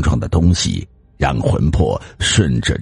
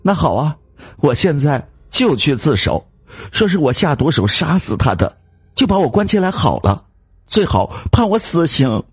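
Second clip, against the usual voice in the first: high, rushing bitter threat; tearful defiance; 11 semitones higher than usual. Acted. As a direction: storm off threatening. Wife threatening to confess. (哭着赌气)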